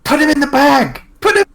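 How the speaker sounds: very high, loud comic shriek; frantic shouted order. Acted. Player looks at teammate and orders him, frantic shriek. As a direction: yell it urgently in a squeaky high voice. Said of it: "I'm looking at Loard and going".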